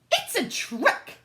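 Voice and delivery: high, slow accusing cry; the queen accuses the girl, high and slow; suspicious queen. A narrator's reading. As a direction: unmask the trick as a shrill queen. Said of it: "she declared".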